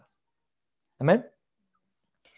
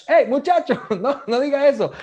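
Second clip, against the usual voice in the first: higher and louder, scolding through laughter; dad scolding his son, laughing; 10 semitones higher, 6 dB louder than usual. Retold or imitated. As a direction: call out from across the room, half laughing. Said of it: "tú me oyes de allá".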